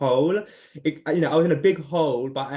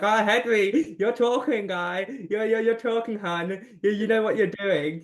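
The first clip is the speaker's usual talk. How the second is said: higher, sassy self-hype; proud self-praise; 6 semitones higher than usual. Retold or imitated. Praising his own present media self, higher and sassy. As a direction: hype yourself up like a proud friend. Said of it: "I'm like".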